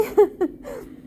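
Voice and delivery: short laugh-sob; real emotion spilling over; tearful laugh. Real, not acted.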